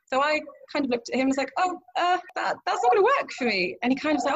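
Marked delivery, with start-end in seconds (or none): 1.6-3.8 squeaky, much higher, awkward refusal; high-pitched dismay. Retold or imitated. To boyfriend refusing ice skating, high awkward dismay. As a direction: pitch way up, politely horrified.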